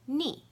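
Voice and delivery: "ni" short, falling sharply from high; fourth tone. Shown as a demonstration. Teacher showing the four tones, "ni" falling. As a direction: drop "ni" fast from the top. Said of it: "I'll say the four tones".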